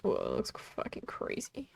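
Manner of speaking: awed hushed whisper. Real, not acted. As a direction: breathe it out in quiet awe. Awed at the screen.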